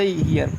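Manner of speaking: "हीयर" as hee-ar, ee gliding into a; correct English sound. Shown as a demonstration. Teacher gives the English version as correct, "हीयर" with ee-a glide. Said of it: "शुद्ध यही है अंग्रेजों के हिसाब से".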